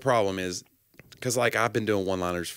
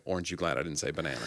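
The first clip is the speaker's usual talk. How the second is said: quiet throwaway; 5 semitones lower, 10 dB quieter, faster than usual. Shown as a demonstration. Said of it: "I consider a dad joke like".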